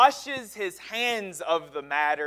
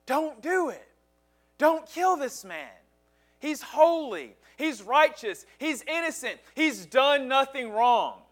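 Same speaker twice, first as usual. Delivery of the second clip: raised-pitch urgent warning; a voice in his head begging him to stop; inner conscience voice; 6 semitones higher than usual. Retold or imitated. Pilate's conscience urging him not to kill Jesus, higher pitched. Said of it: "His conscience was bothering him".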